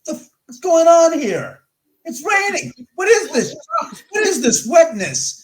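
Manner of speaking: high, loud, outraged dog voice; dog's voice. Retold or imitated. Owner voicing his thick-coated dog, disgusted by the rain, high and loud. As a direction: squeal it out like a baffled wet dog. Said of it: "My dog that's got the thicker coat goes".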